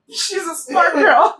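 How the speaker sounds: lento e mais agudo, debochado; Edward irônico. Retold or imitated. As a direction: arraste a frase com deboche. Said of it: "ele fica, tipo". (Edward zombando, devagar e agudo)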